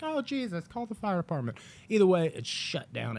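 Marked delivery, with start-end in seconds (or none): 0.0-1.6 high, lightly panicked imitation; mock alarm. Theater staff spotting stove fire, mock alarm. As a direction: act out a pitched-up fluster.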